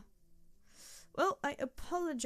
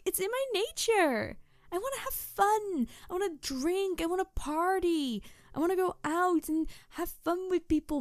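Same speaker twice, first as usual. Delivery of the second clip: eager, excited; 7 semitones higher than usual. Acted. Tanuki telling the stoic human it wants to party, eagerly higher. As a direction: sell how much you crave a party. Tanuki gushing about loving fun.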